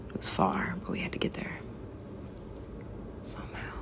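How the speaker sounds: hushed secretive whisper. Acted. Mock-mysterious alien backstory.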